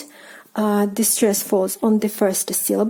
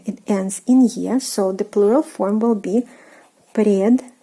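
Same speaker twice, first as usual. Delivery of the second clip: normal talking voice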